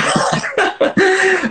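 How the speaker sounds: high-pitched burst of laughter; real laugh. Real, not acted. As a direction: crack up laughing. Real laugh at the odd story.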